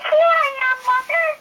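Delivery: put-on high, loud baby bird call. Baby bird calling to a plane, high loud call. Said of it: "he called out".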